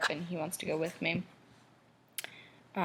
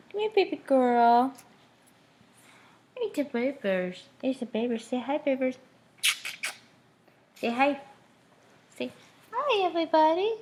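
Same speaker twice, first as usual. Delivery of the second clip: much higher, louder sing-song cooing at the pet; 8 semitones higher, 6 dB louder than usual. Real, not acted. Cooing to her dog.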